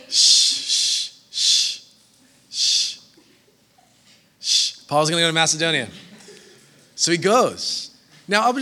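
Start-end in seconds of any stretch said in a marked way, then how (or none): 0.1-4.8 shushing. Real, not acted. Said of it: "hushing the room".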